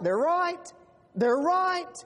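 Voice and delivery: emphatic preacher's admission; emphatic agreement. Real, not acted. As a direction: concede the point with punch.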